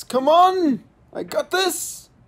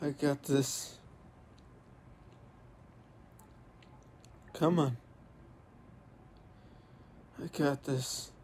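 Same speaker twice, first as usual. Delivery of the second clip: quiet self-reassurance; 6 semitones lower, 16 dB quieter than usual. Real, not acted. Calming self after fall.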